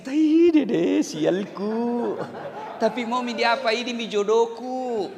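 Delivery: laughing while voicing a grumbling wife; mock wife's complaint. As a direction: play the wife grumbling but half laughing. (istri pura-pura mengeluh sambil tertawa)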